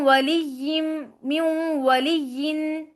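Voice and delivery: म्यू वलियिन slow, नून merged into व with nasal ghunna; म्यू वलियिन with a long nasal hum; nasalized recitation. Shown as a demonstration. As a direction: draw the sound through the nose, slow. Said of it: "नाक के मासे में ... आवाज को लेकर जाना है".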